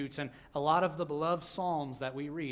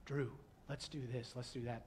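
hushed plotting; 3 semitones lower, 9 dB quieter than usual. Retold or imitated. Kid plotting, quoted quietly.

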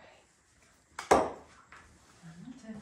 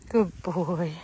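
loud praise; 2 semitones lower, 10 dB louder than usual. Real, not acted. Praising and coaxing the animal.

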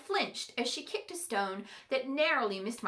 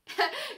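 high burst of laughter; 12 semitones higher than usual. A narrator's reading. Ember laughs at the fairy's claim.

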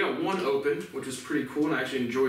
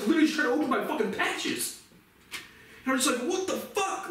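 high, exasperated cry of frustration; exasperated outburst; 9 semitones higher than usual. Real, not acted. Venting about trying to open patches, exasperated. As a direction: let the frustration push your pitch right up.

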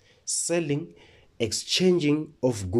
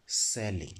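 low quiet term; 6 semitones lower, 7 dB quieter than usual. Shown as a demonstration. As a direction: drop the word low and soft, falling. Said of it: "We also have the next term".

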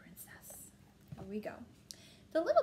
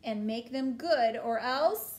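loud princess ultimatum; bellowed threat; 10 dB louder, slower than usual. A narrator's reading. Princess threatening parents over ponies, bellowing. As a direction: deliver a loud, bossy threat. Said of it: "bellowed the little princess".

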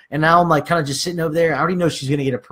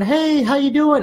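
high, slow mock receptionist; put-on high voice for the rep; phone rep voice; 9 semitones higher, slower than usual. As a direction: drawl a high-pitched phone greeting. Voicing the phone representative.

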